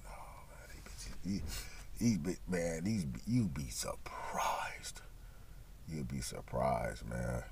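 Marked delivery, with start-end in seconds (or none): 0.0-5.0 low, slow whisper; slow hushed whisper, confiding; whispered aside. Retold or imitated. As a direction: whisper it like a secret tip. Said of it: "I said".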